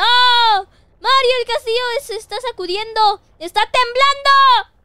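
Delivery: high, alarmed shouting; panicked cry. Acted. Warning Mario that the castle is shaking, high panicked shout. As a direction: raise the alarm, voice pitched high and loud.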